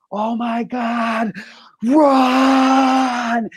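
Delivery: high, drawn-out imitated scream; imitated bloody-murder scream. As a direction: stretch each word into a high panicked cry. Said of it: "screams bloody murder".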